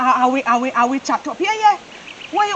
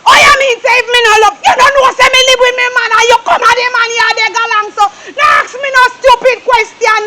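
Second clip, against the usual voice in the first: angry shout; 3 semitones higher than usual. Acted. Man shouting back when his lover says he doesn't love her, tearful angry yell. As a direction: blow up at her, close to tears. Heated quarrel with crying shout.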